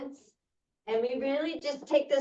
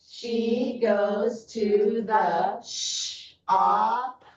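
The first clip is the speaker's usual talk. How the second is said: very slow, each word stretched; slow choral reading; 3 dB louder, much slower than usual. Shown as a demonstration. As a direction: read word by word for a class. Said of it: "so it's gonna go like this".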